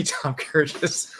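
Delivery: giggling through the misspoken name; laughing slip. Real, not acted. Stumbling over the name while laughing at himself.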